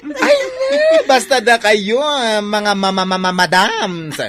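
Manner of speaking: high, loud, laughing squeal to customers; squealing gush. Acted. Parlor owner squealing with delight at her office customers. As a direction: gush over the office ladies with a shrieking laugh.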